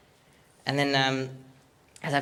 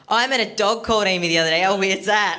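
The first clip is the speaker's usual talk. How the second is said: awkward blurt; 6 semitones higher, 5 dB louder than usual. Retold or imitated. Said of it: "and I said".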